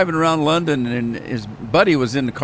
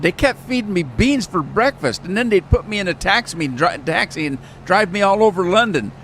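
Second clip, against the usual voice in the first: retelling the comedian; 7 semitones higher than usual. Retold or imitated. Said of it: "And he said".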